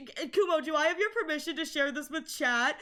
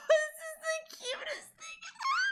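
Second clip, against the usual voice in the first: very high, squeaky gushing; squeal, overcome by how cute it is; cuteness squeal; 13 semitones higher, 3 dB quieter than usual. Real, not acted. Streamer gushing over Kumo's drawing, high delighted squeal.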